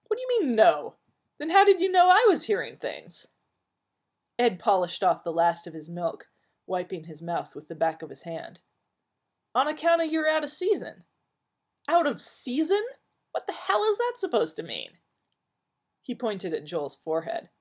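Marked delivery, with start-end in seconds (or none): 0.1-3.3 high, loud baffled outburst; indignant confusion. Acted. Doctor baffled at Ed, loud indignant disbelief. 9.5-11.0 high, loud homespun answer; matter-of-fact oddball wisdom; Ed folksy. Acted. Barfly explaining to Joel, folksy and high. 11.9-15.0 high, loud disbelieving; what the hell; incredulous. Acted. Doctor questioning Ed, high and incredulous.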